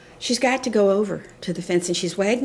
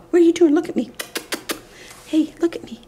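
scolding the dog; 9 semitones higher, much faster than usual. Real, not acted. Breaks off to check the dog.

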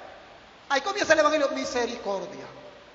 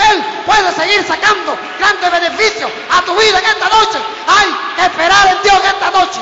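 louder and louder, shouting the refrain; predicación con fuerza; 2 semitones higher, 8 dB louder than usual. Acted. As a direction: preach it full force to the church. Preaching, congregation lifts hands. (predicación a gritos, cada vez más fuerte)

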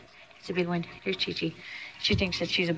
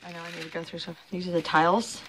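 ordinary talk, nothing marked

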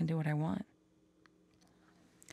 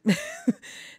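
squeaky embarrassed laugh; awkward high-pitched giggle; high nervous laugh; 5 dB louder than usual. Real, not acted. Nervous laugh after confession.

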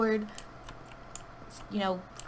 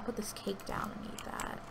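whisper; 9 dB quieter than usual. Real, not acted. Whispering while sneaking in game.

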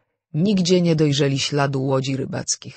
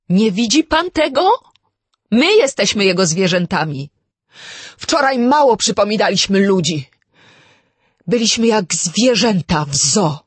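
much higher and louder, desperate; frantic; 7 semitones higher, 10 dB louder than usual. A narrator's reading. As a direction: force him to see it, near panic. Vera frantic, convincing Blor. (Wera do Blora, rozpaczliwie, wysoko i głośno)

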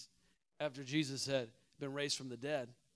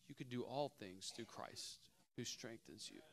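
quiet reading; 4 semitones lower, 15 dB quieter than usual. Shown as a demonstration. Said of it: "Some of us need to begin to declare that".

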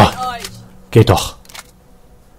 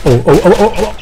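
rising panicked oh oh; startled by the enemies attacking; alarmed oh oh; 6 semitones higher, 6 dB louder than usual. Real, not acted. Gamer startled by enemies, panicked cries.

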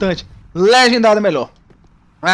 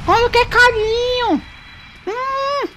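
put-on high, slow baby voice on "Quando quer carinho"; sing-song pet talk; cutesy baby talk; 12 semitones higher, slower than usual. Shown as a demonstration. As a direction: coo it like talking to a puppy. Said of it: "repeats in cutesy voice".

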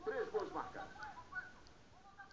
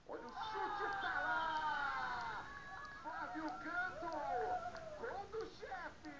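explosive goal commentary; 5 semitones higher, much slower than usual. Real, not acted. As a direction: stretch out the goal. Goal call in match commentary.

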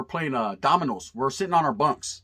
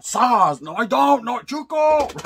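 high, loud, mock-desperate begging, slowing down on No, Chuco; hammed-up whimpering plea to be spared; mock panicked pleading; 9 semitones higher, 7 dB louder, much slower than usual. Beaten storyteller mocking his own panicked plea to Chuco, high and loud.

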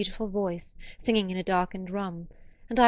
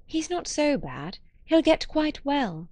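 1 reassuring; 7 semitones higher, slower than usual. A narrator's reading. Comforting a weeping woman.

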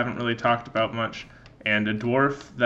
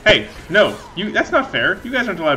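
indignant protest; 7 semitones higher, 6 dB louder than usual. Real, not acted. Enemies ambush from under props.

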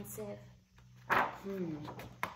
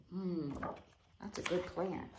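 ordinary delivery, nothing marked

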